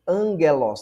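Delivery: "angelos" said with the gamma as a nasal "ng". Shown as a demonstration. Teacher modelling "angelos" for students, gamma as N. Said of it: "pronuncia-se como N".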